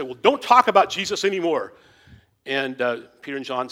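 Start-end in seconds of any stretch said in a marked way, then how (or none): 0.0-1.7 loud, higher stern warning; threatening. Retold or imitated. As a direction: threaten the apostles loudly. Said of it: "they threaten them and say".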